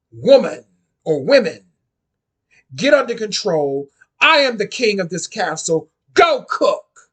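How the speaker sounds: loud mock royal order to his wives; commanding shout. Man with sword orders his wives: loud mock command. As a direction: bark it like a self-crowned king.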